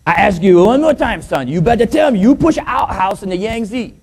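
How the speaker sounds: insistent joke father, put-on Chinese accent; mock Chinese accent. Father demanding an answer from his son, mock Chinese accent. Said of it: "this little Chinese boy".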